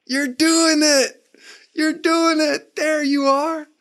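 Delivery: very high, slow, drawn-out cheer; delighted cheering. Acted. Coach cheering men toward freedom, delighted high cheer. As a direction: sing out encouragement high above your own voice. Same cheer given to listeners.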